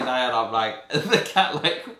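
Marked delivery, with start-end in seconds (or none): none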